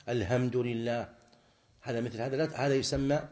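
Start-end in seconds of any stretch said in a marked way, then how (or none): 0.1-1.1 "الحمد" with ح turned into a plain h, said slowly; ha for ha sound. Shown as a demonstration. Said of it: "أو يقلب الحاء هاء".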